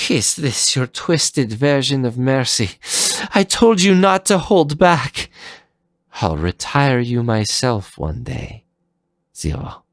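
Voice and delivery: high, sharp offended villainess; indignant threat. Acted. Leviathan threatening Zero while retreating, indignant. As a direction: vow revenge while wounded.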